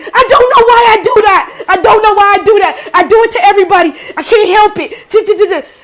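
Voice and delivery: high, loud wailing apology; hammed-up hysterical outburst; mimicked breakdown. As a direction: imitate someone falling apart as they confess. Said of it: "So the girl breaks down".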